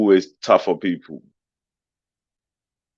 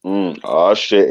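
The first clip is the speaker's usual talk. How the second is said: usual speaking voice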